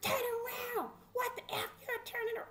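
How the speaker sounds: very high, scolding order; mimicked guard's high-pitched rebuke; imitated sheriff's holler. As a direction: snap at a prisoner for turning. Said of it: "somebody said".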